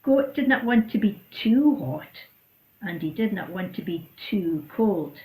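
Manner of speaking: ordinary delivery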